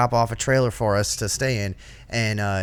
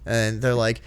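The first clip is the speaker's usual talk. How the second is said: ordinary talk, nothing marked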